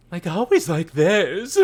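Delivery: lightly voiced flamboyant villain; a hint of a campy cartoon villain; James from Team Rocket voice. Shown as a demonstration. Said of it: "one of the voices I could do properly".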